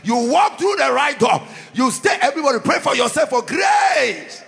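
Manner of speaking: rally the congregation to stand firm; preaching. Real, not acted. Rising preaching exhortation.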